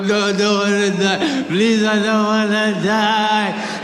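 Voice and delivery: slow, half-sung "please, I don't wanna die"; hammed-up pleading wail; mock wail. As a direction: wail it like a melodrama. Sung-out wail while stuffed back.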